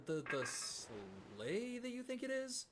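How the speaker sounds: slow, drawn-out slangy delivery; slow drawn-out slay, slangy and knowing; slang. Acted. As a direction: stretch it out like trendy slang. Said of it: "I don't talk like that".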